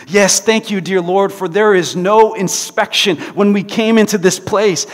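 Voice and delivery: loud, high thankful prayer; joyful prayer. Acted. Betsy praising God in the barracks, loud. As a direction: thank God with full joy.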